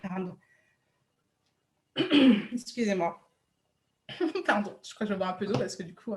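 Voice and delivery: coughing after swallowing wrong; choking on water, recovering; choking on a drink. Real, not acted. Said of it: "j'ai avalé de travers".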